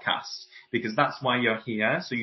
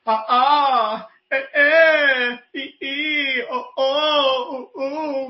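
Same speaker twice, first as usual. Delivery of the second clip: high, wailing vowel warm-up; cry in the voice; 13 semitones higher, 6 dB louder, much slower than usual. Shown as a demonstration. Voice coach demonstrating a warm-up, big crying voice. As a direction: complain loudly up high on each vowel. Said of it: "a big old cry in the voice".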